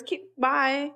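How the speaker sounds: ordinary delivery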